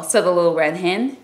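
ordinary delivery, nothing marked

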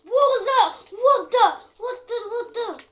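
high startled exclamations. Real, not acted. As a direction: blurt out in shock.

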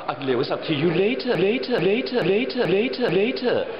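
Stewie impression. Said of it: "do Stewie".

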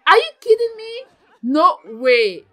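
loud astonished outcry; disbelieving shout. Real, not acted. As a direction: yell it in shock. Disbelief at game.

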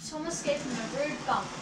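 ordinary delivery, nothing marked

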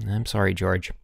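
ordinary delivery, nothing marked

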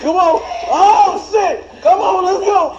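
high, loud breathless urging; excited yelling. Real, not acted. Racing friends up stairs, excited loud yelling. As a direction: push them up the stairs.

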